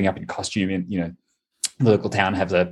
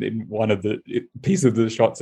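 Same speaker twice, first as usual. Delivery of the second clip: ordinary talk, nothing marked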